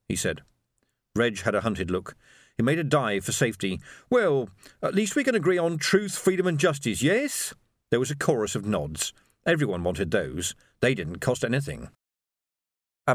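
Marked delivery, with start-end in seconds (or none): 4.1-7.5 high, appealing voice rising at the end; hopeful revolutionary. A narrator's reading. Revolutionary seeking agreement from his comrades, hopeful.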